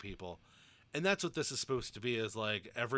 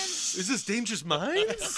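high, loud, slow disbelief; mock bafflement; 12 semitones higher, 8 dB louder, slower than usual. Mock confusion at the scene.

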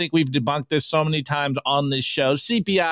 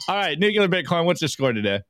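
ordinary talk

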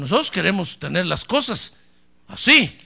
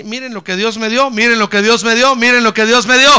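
repeated, swelling louder with each line; building proclamation; 3 semitones higher, 12 dB louder than usual. Retold or imitated. Hannah showing her son at the temple, building to a loud cry.

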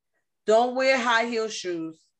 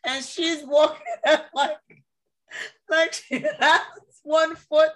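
high, breaking up with laughter; laughing while talking; 4 semitones higher than usual. Real, not acted. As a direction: barely get it out for laughing. Cracking up while telling the story.

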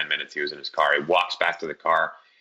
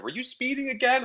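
mom's voice. Retold or imitated. Mom voiced in retold story.

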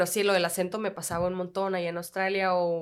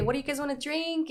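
bright, higher American English waiter voice; American accent; 8 semitones higher than usual. Retold or imitated. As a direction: greet them in your best American accent. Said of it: "con mi acento americano".

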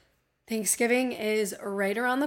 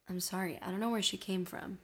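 normal talking voice

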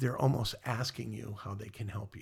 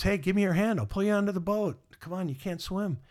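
higher, louder rescuer's call; urgent calling out; 8 semitones higher, 4 dB louder, faster than usual. Acted. Boatman offers a drowning man his hand, urgent calling out. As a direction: call out insistently to someone in danger.

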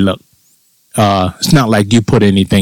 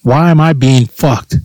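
ordinary delivery

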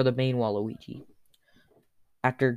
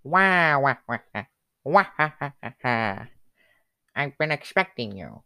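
Waluigi villain laugh. Acted. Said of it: "He's like".

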